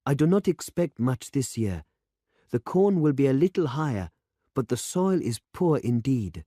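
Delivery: put-on a worried young farmer explaining the poor soil; young demonstrator. A narrator's reading. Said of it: "said the young man".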